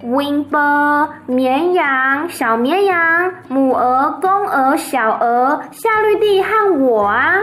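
put-on high, bright-eyed little girl voice; little Fern chattering to her parents, high and eager. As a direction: tell it like an eager child reporting news. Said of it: "然后芬就说".

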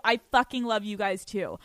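ordinary delivery

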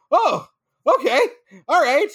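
surprised. Retold or imitated. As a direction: react as if caught off guard. Retelling surprise at callback.

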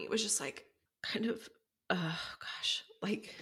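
crying. Real, not acted. Host breaking down mid-story to listeners, whispered crying. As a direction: lose the voice to real tears. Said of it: "hold on, y'all".

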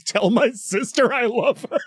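hammed-up farewell, breaking into laughter at the end; mock last words. Podcaster offering better last words, mock dying voice. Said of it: "instead of being like".

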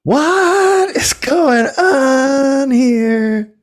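very high, drawn-out bewildered cry; baffled. Retold or imitated. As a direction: stretch it out in high disbelief. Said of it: "me and the other guy are like".

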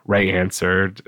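usual speaking voice

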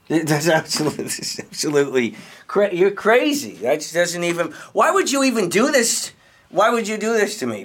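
high-pitched indignant outburst; rising outrage at the accusation; outraged. Acted. Angry suspect accused of murder.

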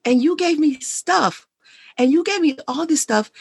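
mock wife pouring out her hurt. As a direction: beg him to see she wanted him, not things. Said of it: "And she's like".

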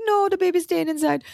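nanny voice, pitched high and set apart from her own; poorly imitating the protective nanny; bad Myrna impression. Telling a friend about her old nanny, a lightly done high nanny voice. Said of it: "this impression is so bad".

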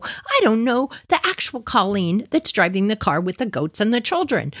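usual speaking voice

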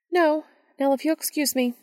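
sharp, dismissive woman; brusque dismissal. Acted. Brusquely ending conversation.

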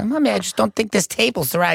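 high fussy mom voice; imitating mom. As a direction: fuss about the table up high. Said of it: "She goes".

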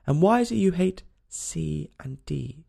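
usual speaking voice

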